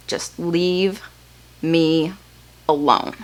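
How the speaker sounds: little Matt brushing off the nurse, soft and curt; sullen toddler. Retold or imitated. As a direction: shut her out, one word at a time. Toddler brother answers nurse.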